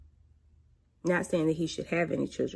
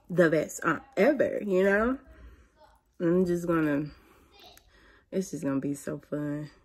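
ordinary talk

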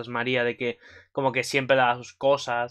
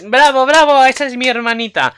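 high, loud proud cheer for her sister; Luna cheering; 11 semitones higher, 10 dB louder than usual. Acted. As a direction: shout bravo like a proud big sister. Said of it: "Luna dice". (vitoreando a su hermana, agudo y fuerte)

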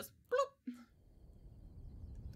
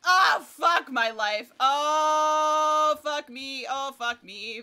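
loud drawn-out cry of dismay; panicked shouting; 5 semitones higher, 12 dB louder, much slower than usual. Real, not acted. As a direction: yell out in gaming panic. Panicking at enemy attack.

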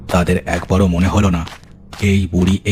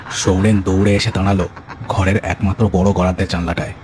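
ordinary delivery

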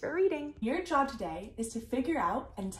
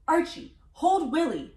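louder, higher urgent command; raised voice, punching each word; urgent call; 7 semitones higher, 6 dB louder than usual. Shown as a demonstration. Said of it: "I should read that line".

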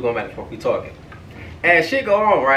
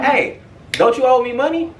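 high-pitched calling-out question; confronting; 9 semitones higher than usual. Retold or imitated. Storyteller confronts the scammer found after stalking, pointed and high. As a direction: call him out over the debt.

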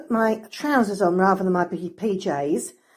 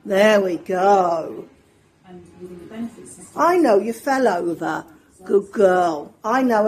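ordinary talk

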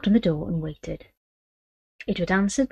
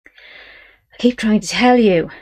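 sighing; 4 semitones higher, 7 dB louder, much slower than usual. Acted. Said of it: "her great-grandson sighed".

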